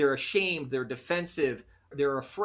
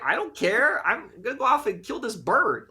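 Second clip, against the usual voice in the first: high, loud dismissive cat voice; snooty cat brushing off everyone, pitched high; aloof cat; 8 semitones higher, 4 dB louder than usual. Retold or imitated. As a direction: play the cat who couldn't care less. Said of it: "the cat's like".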